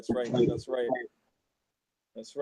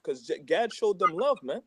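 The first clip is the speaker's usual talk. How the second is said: normal talking voice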